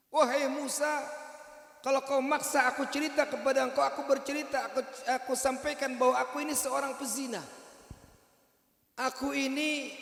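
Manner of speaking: woman admitting her sin to Musa; woman confessing. Acted. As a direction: confess with shame.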